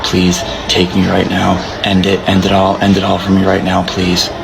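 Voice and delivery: low, fast mock despair; melodramatic plea. Begging the court to end it, hammed-up despair. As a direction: beg to be ended, hammed-up.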